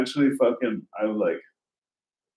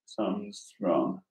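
slow, hushed retelling of dread; scared; 3 semitones lower, 6 dB quieter, slower than usual. Retold or imitated. Waking man sensing something in his room, scared hush. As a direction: drop low in level, slowly, as if sensing danger. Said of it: "I'm scared".

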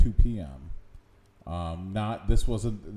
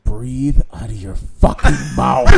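hushed scolding; 6 dB louder than usual. Retold or imitated. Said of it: "he leans over to me and he goes".

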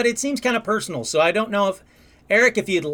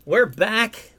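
ordinary delivery

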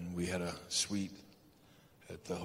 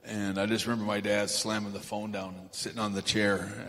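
ordinary talk

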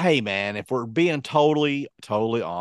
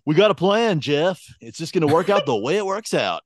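louder, higher arrogant boast; cocky; 6 semitones higher, 4 dB louder than usual. Retold or imitated. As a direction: swagger it at the host. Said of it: "all arrogant and cocky".

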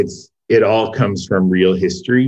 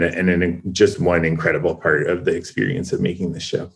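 usual speaking voice

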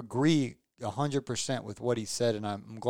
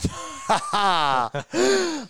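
a sudden, loud, high laugh; high burst of laughter; 13 semitones higher, 6 dB louder than usual. Real, not acted. Laughing at his own joke.

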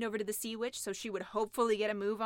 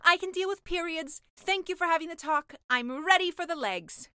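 higher, louder keen mermaid; eager mermaid; 6 semitones higher, 7 dB louder than usual. Acted. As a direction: push ahead eagerly.

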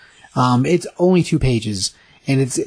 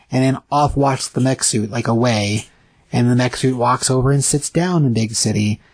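normal talking voice

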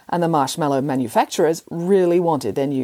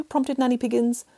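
usual speaking voice

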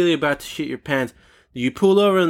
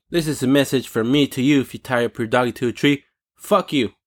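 ordinary talk, nothing marked

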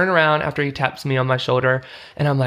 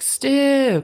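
higher, shouted over the club noise; mock yell over music; 12 semitones higher than usual. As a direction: yell your name across the bar. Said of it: "'cause it's so loud".

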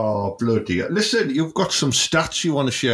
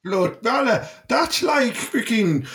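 ordinary talk, nothing marked

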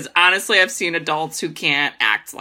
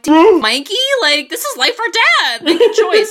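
loud, high, laughing exasperation; exasperated outburst; 11 semitones higher, 5 dB louder than usual. Retold or imitated. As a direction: throw it out half laughing, fed up. Retelling own exasperated reaction.

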